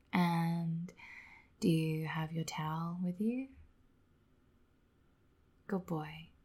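soft tender whisper. Real, not acted. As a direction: whisper gently to him.

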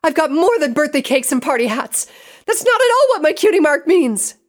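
high-pitched, full-voiced protest; indignant shout. A narrator's reading. Pinkie defending her cutie mark, indignant shout. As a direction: yell it high and loud to be taken seriously. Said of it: "Pinkie shouted".

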